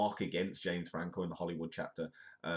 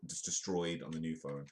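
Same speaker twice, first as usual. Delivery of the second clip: normal talking voice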